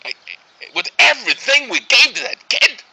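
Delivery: loud, high-pitched frustration; exasperated parent. Acted. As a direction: let the parental grievance burst out. Father exasperated about his son.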